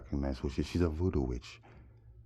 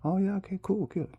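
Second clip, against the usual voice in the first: very high, loud falsetto; hammed-up squeaky okay; mock agreeable woman; 6 dB louder than usual. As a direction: imitate her eager acceptance. Voices the woman agreeing to walk.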